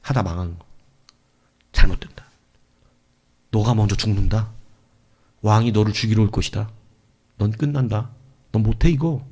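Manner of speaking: low, ominous warnings in separate short bursts; intimidating threats. Acted. Preacher voicing the devil's scare tactics, low intimidating threats. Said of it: "마귀는 그런 겁을 준단 말이에요".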